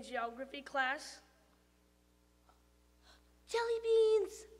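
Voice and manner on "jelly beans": high, muffled little voice from the back; a hidden tooth calling out, higher. Acted. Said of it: "said a muffled voice".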